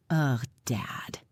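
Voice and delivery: whispered, weak groan; quiet whisper. A narrator's reading. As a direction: breathe it out in a whisper. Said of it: "in a quiet voice".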